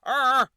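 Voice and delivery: a loud high "ah" that sounds crazy; botched "ah" imitation. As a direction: blurt the "ah" like a startled squawk. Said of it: "but instead he went".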